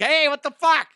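high, loud, bewildered yelling; a confused person waking at their own funeral, yelling in alarm; waking corpse freaking out. Retold or imitated. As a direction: shriek like you just sat up in a coffin. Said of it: "They're like".

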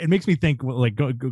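ordinary delivery

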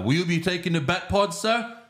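high, rising butler's question; Alfred. Retold or imitated. Butler asking his master which vehicle, high and lilting. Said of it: "Alfred's like".

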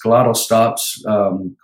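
ordinary delivery

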